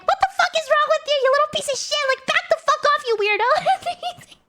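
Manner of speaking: high, fast mock tirade, half laughing at the end; retold angry screaming. Traveller screaming at the man kicking her luggage, mock-furious high rant. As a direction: re-enact screaming abuse in a high, rushed voice. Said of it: "screaming at him".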